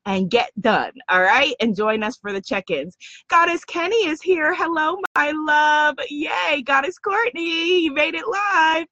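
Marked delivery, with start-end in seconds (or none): none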